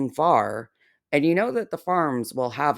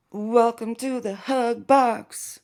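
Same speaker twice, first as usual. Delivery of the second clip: slower high sarcastic welcome; mock welcome; 8 semitones higher than usual. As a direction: stretch "Welcome to the hug box." like a fake host. Repeated slower.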